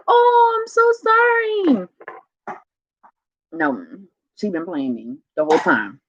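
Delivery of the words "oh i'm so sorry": very high, drawn-out gushing coo; retold cooing apology. Retelling how she fell for fake crying, high cooing sympathy. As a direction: gush it high and slow, as to a baby. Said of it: "For a while, I'm like".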